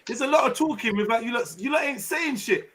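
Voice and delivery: high, animated calling-out of friends; exasperated challenge. Real, not acted. Teasing friends for saying nothing, high exasperated. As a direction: rise up and call them out.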